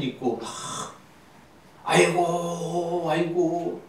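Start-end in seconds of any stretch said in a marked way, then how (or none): 1.8-3.8 drawn-out imitation mourner's wail; mock funeral wailing. Luther's wife in mourning clothes, imitated drawn-out wailing. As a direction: imitate a slow, keening lament. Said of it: "저상집에서 울음소리 마냥".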